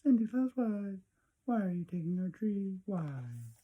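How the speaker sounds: put-on high, small child's voice; Cindy Lou asking the Grinch why he takes the tree, high and small; little girl voice. A narrator's reading. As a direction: ask like a tiny puzzled girl. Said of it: "She stared at the Grinch and said".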